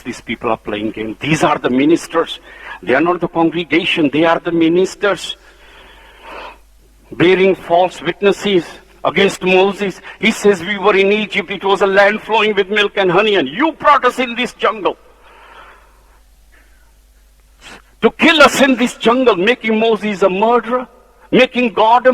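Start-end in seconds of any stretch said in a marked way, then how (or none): 2.9-10.6 preaching at full force. Acted. Preacher rousing the church against the rebels, fervently. 10.6-19.4 high, whining grievance that swells louder at the end; complaining rebels. Acted. Rebels accuse Moses of bringing them to die, whining.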